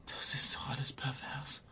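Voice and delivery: aghast hushed whisper. Acted. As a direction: breathe it out in disgust. Verzweifelte Mutter, Entführung. (entsetztes Flüstern)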